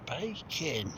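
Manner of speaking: dry, strained voice from thirst; dehydrated voice. Real, not acted. Hiker parched in the sun, dry strained voice. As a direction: rasp it out, parched and worn. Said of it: "you can tell by my voice".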